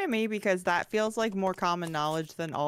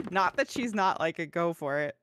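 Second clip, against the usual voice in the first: usual speaking voice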